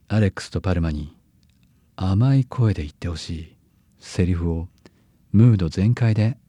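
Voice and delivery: low, hushed ghost-story tone; spooky low voice. Acted. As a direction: meant honeyed, lands creepy. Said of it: "甘い声じゃなくてちょっと怖いわ". (低く抑えた怪談調の声)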